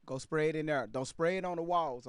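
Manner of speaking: ordinary delivery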